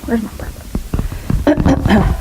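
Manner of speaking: slow unvoiced mutter to herself; slow whisper. Real, not acted. Minister searching the pulpit, whispering slowly.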